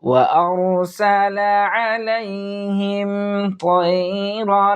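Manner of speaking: slow, melodic tajweed recitation; measured Quranic chanting with long pauses. Acted. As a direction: chant each word long and drawn out. Recites Surah Al-Fil.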